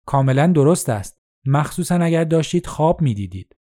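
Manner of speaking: ordinary delivery, nothing marked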